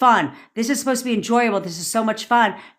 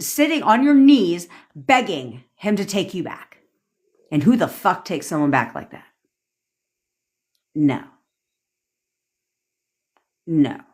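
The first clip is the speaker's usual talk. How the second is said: normal talking voice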